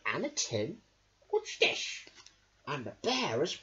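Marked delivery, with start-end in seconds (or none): 1.3-2.1 high breathy curious voice; whispered dog voice. Acted. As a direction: sniff it out curiously. Dog finds the tin.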